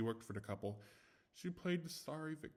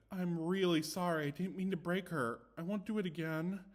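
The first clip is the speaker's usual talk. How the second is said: higher, pleading apology; apologetic maid; 6 semitones higher, 3 dB louder than usual. A narrator's reading. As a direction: beg forgiveness, slightly raised. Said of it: "Maid saw this quickly and said".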